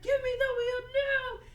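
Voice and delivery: very high, loud hammed-up demand; mock frantic yell. Pilot demands the wheel as plane burns, mock frantic yell. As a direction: cry out in pretend desperation, high and loud.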